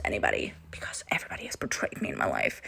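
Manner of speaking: hushed, mock-tragic aside; mock dramatic whisper. Joking about her trust issues, hushed mock drama. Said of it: "please cue the dramatic music".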